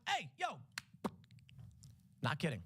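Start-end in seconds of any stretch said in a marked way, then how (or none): 0.1-0.6 calling out. Retold or imitated. Canvasser hailing a man walking by, high call. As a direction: hail him across the street. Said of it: "and I went".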